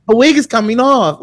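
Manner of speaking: a shocked English lady, high. Retold or imitated. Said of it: "it was the accent".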